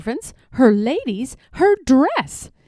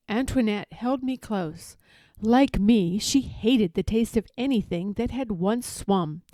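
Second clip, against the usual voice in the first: usual speaking voice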